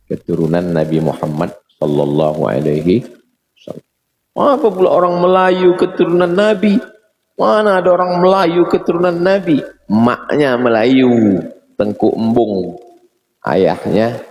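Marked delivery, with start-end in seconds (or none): 4.6-9.6 high-pitched, indignant mock challenge; incredulous objection. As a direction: act out a scoffing objector in a much higher voice. Mimics objectors doubting the lineage.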